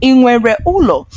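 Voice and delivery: questioning ị nwere ụlọ, high then dropping. Shown as a demonstration. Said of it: "They say it as a question".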